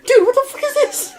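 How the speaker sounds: high, laughing shock; mock shocked outrage. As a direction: squeal in shocked disbelief. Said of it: "And she's like".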